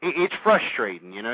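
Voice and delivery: normal talking voice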